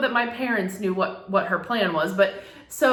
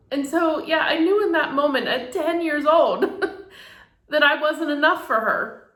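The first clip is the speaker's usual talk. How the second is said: tearful, raised pitch, pushing on; trying not to cry; 7 semitones higher than usual. Real, not acted. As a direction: hold back real tears while confessing. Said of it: "I'm trying not to cry".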